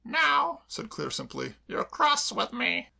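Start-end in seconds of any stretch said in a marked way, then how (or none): 0.0-0.6 high, chirpy cockney maid voice; perky maid reassuring her mistress; Claire the maid. Acted. 1.7-2.9 tearful. Acted. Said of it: "burst into a passionate flood of tears".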